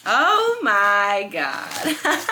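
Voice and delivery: drawn out, then a very high shrieking laugh; squealing excitedly. Real, not acted. Unboxing the new book, squealing. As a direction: squeal with delight.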